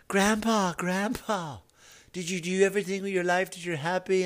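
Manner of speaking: small soft voice at the bedside; grandchild's voice. Pretend.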